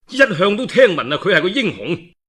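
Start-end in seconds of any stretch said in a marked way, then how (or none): none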